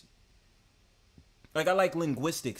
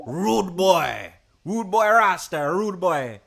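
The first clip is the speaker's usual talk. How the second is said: louder put-on Jamaican accent, slow on rude boy; 3 semitones higher, 8 dB louder than usual. Shown as a demonstration. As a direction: hype it up in a mock Jamaican patois. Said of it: "Can I do a Jamaican accent?".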